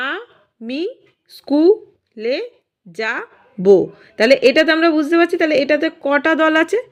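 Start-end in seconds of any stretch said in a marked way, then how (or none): 0.1-3.7 "আমি স্কুলে যাব" very slow, every syllable on its own. Shown as a demonstration. Teacher helping pupils count the syllables, syllable by syllable. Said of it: "এরকম হচ্ছে, তাহলে".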